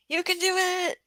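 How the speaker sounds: rally him like a fan; cheering on. Real, not acted. Cheering Jay on in chat.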